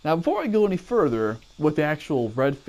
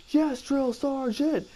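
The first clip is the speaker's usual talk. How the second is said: barked military recruit reply; 11 semitones higher, slower than usual. Retold or imitated. Recruit answering drill sergeant.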